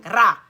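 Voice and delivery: loud barked slam noise; door slam. Retold or imitated. Telling of haunted basement, loud slam noise. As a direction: mimic a door banging shut. Imitating doors slamming.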